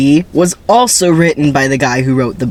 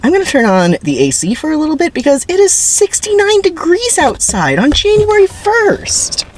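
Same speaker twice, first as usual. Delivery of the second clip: mock voice. Vlogger turning on the AC in January, high goofy voice. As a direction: marvel at the weather in a goofy voice. Joking about warm January.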